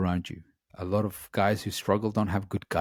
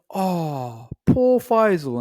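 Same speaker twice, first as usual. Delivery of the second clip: much higher, louder sing-song mock sympathy; mocking pity; 9 semitones higher, 7 dB louder, much slower than usual. Girlfriend teasing Faisal on a walk, mocking pity. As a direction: coo it like fake pity for a sulking child. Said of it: "she went".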